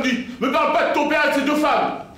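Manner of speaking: loud, high outburst; wife cutting him off, mock furious; angry wife. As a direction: bristle and snap back at him. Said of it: "elle se braque".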